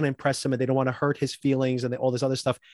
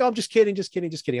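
high-pitched mock brush-off; imitated "only joking" deflection; mock backpedaling; 6 semitones higher, faster than usual. Imitates someone brushing off hurt.

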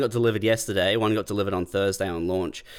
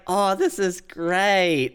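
delighted little sister; 9 semitones higher, much slower than usual. Retold or imitated.

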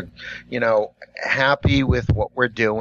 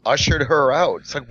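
ordinary talk, nothing marked

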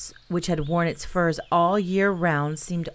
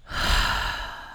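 long sad sigh. Acted. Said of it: "with a long, sad sigh".

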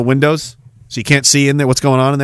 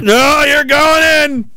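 hammed-up high shout; 12 semitones higher, much slower than usual. Captured camper screaming as he's lowered in, slow high shout. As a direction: scream it as you're dragged off.

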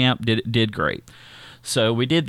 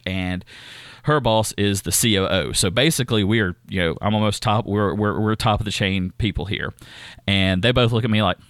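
ordinary talk

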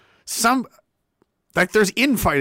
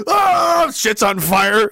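mock panic; 6 semitones higher, 5 dB louder, much slower than usual. Mock panicked newspaper staff.

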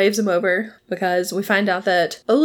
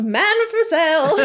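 mock yelling out; 8 semitones higher than usual. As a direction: call it out like a vendor, half laughing. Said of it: "yelling out".

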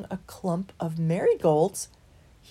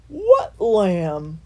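yawning; 7 dB louder than usual. A narrator's reading. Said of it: "yawned Loafer".

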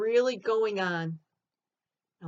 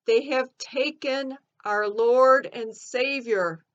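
slow, louder, urgent announcement; raised shout; 4 semitones higher, 7 dB louder than usual. Acted. Mary Magdalene tells disciples the body is gone, raised shout. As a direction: call out the alarm, drawn out. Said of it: "I shouted to the disciples".

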